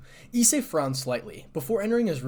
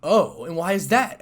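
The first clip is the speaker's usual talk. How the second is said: slow, louder, higher curious question; 6 semitones higher, 10 dB louder than usual. A narrator's reading. Azazel asking Issei for his reason, curiously. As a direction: ask with drawn-out curiosity. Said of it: "asked Azazel curiously".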